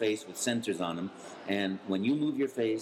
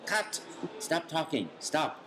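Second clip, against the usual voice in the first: calling cut; 7 semitones higher, slower than usual. Retold or imitated. Producer halts the audition.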